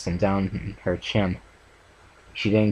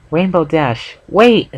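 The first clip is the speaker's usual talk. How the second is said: high, much louder desperate shout; crying out; 9 semitones higher, 8 dB louder, slower than usual. A narrator's reading. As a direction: call her back with everything. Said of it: "Fluttershy cried out".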